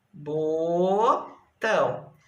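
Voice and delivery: botão very slow, long bo then tão; syllables separated. Shown as a demonstration. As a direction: dictate botão in two isolated syllables. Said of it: "olha lá, separadas".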